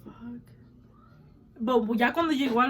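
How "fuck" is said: breathy whispered curse under the breath. Retold or imitated. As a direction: whisper it like a shocked aside to yourself. Retold shocked thought, whispered.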